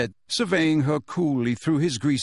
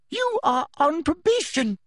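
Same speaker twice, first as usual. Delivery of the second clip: shriek. A narrator's reading. Umbridge shrieking at Snape, much higher. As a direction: lash out in fury. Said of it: "shrieked Professor Umbridge".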